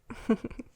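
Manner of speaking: short hushed giggle; playful demon chuckle. Acted. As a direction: chuckle lightly between whispers. Demon teasing about the contract.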